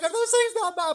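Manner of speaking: high, loud amazed bystander asking; amazed onlooker. Retold or imitated. Crowd on the bridge asking the snake catcher, high and loud. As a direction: squeal it like a shocked spectator.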